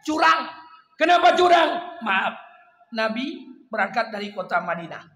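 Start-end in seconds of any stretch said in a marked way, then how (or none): 0.1-2.0 indignant outburst. Acted. Preacher's rhetorical outburst.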